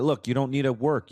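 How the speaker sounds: ordinary talk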